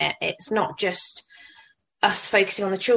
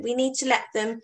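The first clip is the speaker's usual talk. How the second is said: normal talking voice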